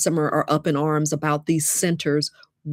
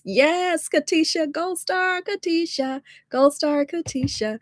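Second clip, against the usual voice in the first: high, drawn-out sing-song cheer; gleeful, high-pitched congratulations; delighted praise; 12 semitones higher, slower than usual. Real, not acted. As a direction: celebrate the right answer like a proud teacher. Cheers viewer's correct answer.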